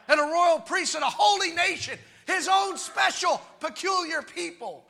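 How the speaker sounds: full-voiced, emphatic preacher; fervent preaching. Real, not acted. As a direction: drive each phrase home to the congregation.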